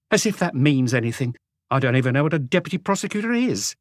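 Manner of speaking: a sulky wife scoffing at authority; scornful housewife. A narrator's reading. Renée scoffing at warrant.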